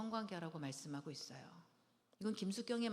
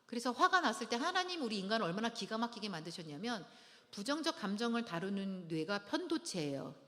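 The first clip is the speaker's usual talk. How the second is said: ordinary talk, nothing marked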